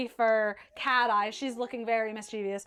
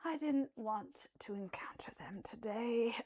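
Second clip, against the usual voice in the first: whisper; 10 dB quieter than usual. Acted. GM voices captain Sapphire.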